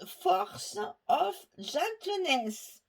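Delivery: English in an unashamed French accent, loud, high and drawn out. Real, not acted.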